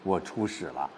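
ordinary talk

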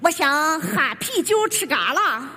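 slow, mocking Shandong dialect; Shandong accent. Mother mimicking how the child portrays her, in Shandong dialect. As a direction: imitate a thick Shandong country accent. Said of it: "演我的时候口音变了".

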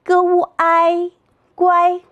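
"g u i 乖" chanted high and level, g, ū, āi, then guāi; three-part blend "g u i 乖", all first tone; pinyin blending. Shown as a demonstration. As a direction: sound out "g u i", then join as "乖" on one high note. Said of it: "我们一起来读一读".

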